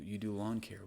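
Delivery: ordinary talk, nothing marked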